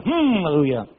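drawn-out falling hum into "aleluya"; mimicked verbal tic. Comic mimicking the young man's constant hallelujahs, mock pious hum. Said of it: "o será así todo el tiempo".

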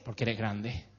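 calm mom. Retold or imitated. Mother answers child calmly.

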